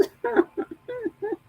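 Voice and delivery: laughing. Real, not acted. Laughs at mismatched neck color.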